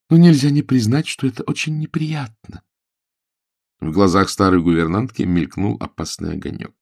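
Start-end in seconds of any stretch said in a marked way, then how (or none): 0.1-2.6 higher, pointed on «очень»; snide remark. A narrator's reading. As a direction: let the snide edge show. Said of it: "ехидство в голосе". (ехидная реплика)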